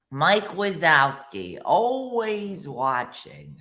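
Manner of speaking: slow, drawn-out cartoon warning; slow impersonation of a watchful monster; Roz from Monsters, Inc. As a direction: draw every word out, keeping tabs on someone. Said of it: "Roz from Monsters".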